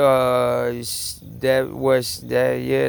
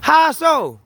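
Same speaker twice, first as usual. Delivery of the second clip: incredulous; 11 semitones higher, 5 dB louder than usual. Real, not acted. Mocking the neighbour's idea.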